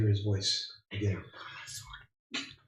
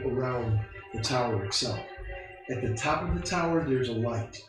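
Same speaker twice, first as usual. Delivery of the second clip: game master narration; 6 dB quieter than usual. Acted. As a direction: paint the scene softly. GM scene narration.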